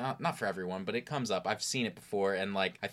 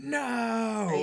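Brian yelling; 5 dB louder than usual. Retold or imitated. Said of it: "you hear Brian on the phone".

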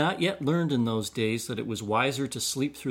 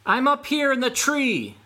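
higher, louder call from up a tree; yelled; 12 semitones higher, 4 dB louder than usual. A narrator's reading. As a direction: shout it down from the treetop. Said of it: "yelled Raccoon".

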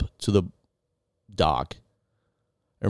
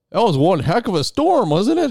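much higher, louder, carefree buddy voice; imitating Jerry; 11 semitones higher, 5 dB louder than usual. A carefree buddy voice, pitched high, ribbing the couple he ditched. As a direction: play the breezy buddy, up high. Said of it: "and he's like".

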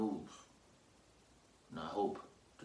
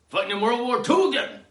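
comic outburst; 14 semitones higher, 12 dB louder than usual. Acted. Comic drug-trip climax.

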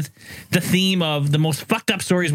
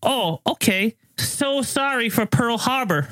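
higher, slow put-on Japanese girl voice; mock Japanese accent; 6 semitones higher, slower than usual. Storyteller voices the girl's reply in the tale, mock Japanese accent. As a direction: imitate her apologetic reply, pitched up and slow. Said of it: "Japanese girls sound anyway".

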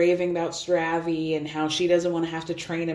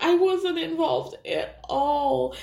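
mock whiny voice; 7 semitones higher, slower than usual. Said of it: "So Danielle's like".

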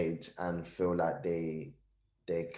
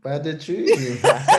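laughing; 9 semitones higher, 13 dB louder than usual. Acted. Echoing the quote, laughing.

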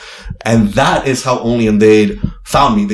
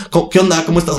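higher-pitched Mexican Spanish greeting; Mexican-accented Spanish, high voice; 7 semitones higher, faster than usual. Retold or imitated. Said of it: "she was like, "Mexico".

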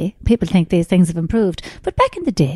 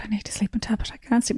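whispered plea for a sleeping tablet; whispering to the night nurse for a sleeping tablet; pleading whisper; 8 dB quieter, faster than usual. Retold or imitated. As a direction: beg for the pill in a whisper.

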